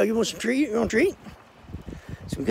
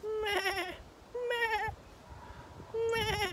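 high soft bleating meh; goat bleat; 14 dB quieter, much slower than usual. Pretend. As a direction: bleat like a little goat, softly. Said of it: "Guess we might learn to speak goat".

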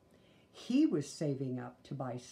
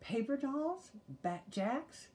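much higher, indignant brother; higher outraged boy; protesting; 10 semitones higher, slower than usual. A narrator's reading. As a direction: protest in disbelief. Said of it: "he protested".

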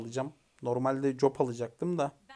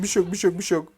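normal talking voice

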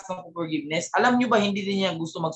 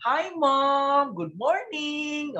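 higher, very slow sugary greeting; fake sweet greeting; 5 semitones higher, much slower than usual. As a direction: drag out a sugary hello to the teacher you complain about. Employee greeting teacher falsely sweet. (mapagkunwaring matamis na bati, mataas at napakabagal)

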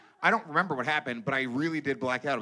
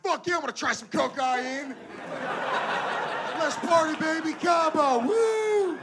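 much higher, whooping party shout; party hype; 4 dB louder, slower than usual. Pretend. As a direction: yell it like you're starting the party in Cabo. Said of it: "You're gonna be like".

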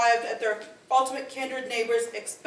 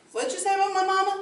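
fast, higher Southern American retort; American accent; 8 semitones higher, faster than usual. Acted. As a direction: fire it back like an offended country boy. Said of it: "hailed from the American colonies".

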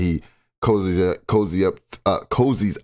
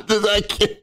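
high, words squeezed through laughter; can barely speak for laughing; real helpless laughter; laughing fit; 13 semitones higher than usual. Real, not acted.

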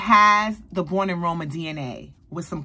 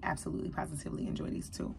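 fast, unvoiced whisper of pure enjoyment; hushed delight; 13 dB quieter, faster than usual. Real, not acted. To viewers about her two new perfumes, a quick hushed whisper. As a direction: breathe it out like a secret treat.

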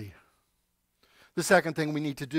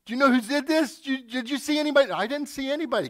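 higher, louder, fast; eager questioning; 8 semitones higher, 4 dB louder, faster than usual. Acted. Coach asking the team, excited. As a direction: coach pressing for answers.

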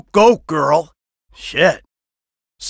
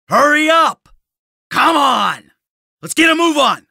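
impatient shouting; 6 semitones higher than usual. Acted. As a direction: yell at them to get moving. Urging someone to hurry.

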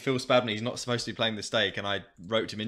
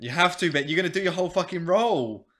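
pleading; 8 semitones higher, 7 dB louder than usual. Real, not acted. Friend pleads him to stop.

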